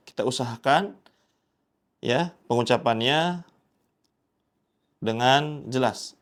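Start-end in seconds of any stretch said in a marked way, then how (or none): none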